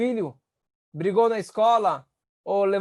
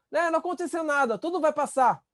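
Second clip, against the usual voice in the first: quick, higher brush-off; dismissive reassurance; 7 semitones higher than usual. Retold or imitated. Parent waving off a crying child, quick and higher. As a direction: hurry past the hurt, chirpy and fast. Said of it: "Você tá falando".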